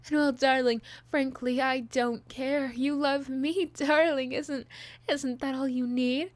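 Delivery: loud, possessive, jealous outburst; jealous lover snapping, laughing; unhinged villain. Acted.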